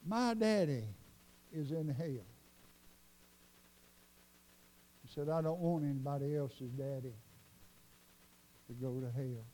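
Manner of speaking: grave confession. Retold or imitated. Retold grave confession about his father.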